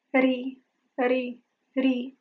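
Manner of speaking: "Ri" as a soft tongue tap plus vowel; softly flick out "ri", the flapped -ty ending; isolated flap. Shown as a demonstration. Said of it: "Flap your palate with your tongue".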